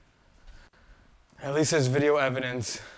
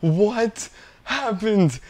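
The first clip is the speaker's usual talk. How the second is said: stunned disbelief; 7 semitones higher, 5 dB louder, much slower than usual. Real, not acted. Disbelief after losing run.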